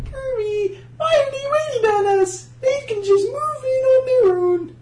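high, squealy pig diva; shrill piggy diva whine; Miss Piggy voice. Acted. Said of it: "hard to get into Miss Piggy".

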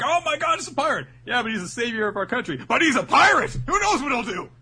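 high, loud mock squabble; hammed-up public panic; alarmed crowd reactions. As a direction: play a flustered crowd arguing in high voices.

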